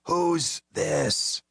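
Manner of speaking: high croaking rasp; hoarse croaking repeat; croaked. A narrator's reading. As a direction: croak it through a wrecked throat. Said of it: "The voice croaked again".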